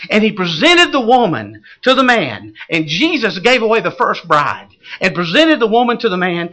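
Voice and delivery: ordinary talk, nothing marked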